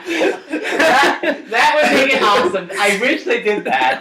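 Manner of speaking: high laughing speech. Real, not acted. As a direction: laugh through the words. Laughing at Neji joke.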